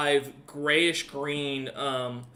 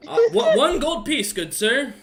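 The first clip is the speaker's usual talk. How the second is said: much higher, louder excited haggling; eager merchant; 11 semitones higher, 8 dB louder than usual. Acted. Merchant naming his price to the buyer, high and eager. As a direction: jump at the sale.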